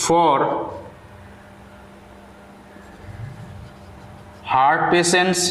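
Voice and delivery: slow, word-by-word dictation with long pauses. Acted. Teacher dictating a paragraph to students, slow and deliberate. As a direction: read each word out clearly so students can write it down.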